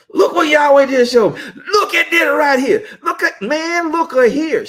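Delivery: high, loud Southern drawl with a laugh; Minister Wanda's Southern accent. Retold or imitated. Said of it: "she got that little Southern accent".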